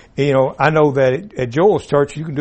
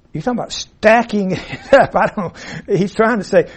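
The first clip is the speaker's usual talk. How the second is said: laughing; 5 semitones higher, 4 dB louder than usual. Real, not acted. Amused while explaining author's wording.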